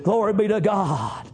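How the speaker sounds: normal talking voice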